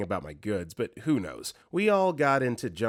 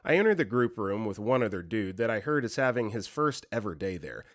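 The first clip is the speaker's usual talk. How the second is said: ordinary delivery, nothing marked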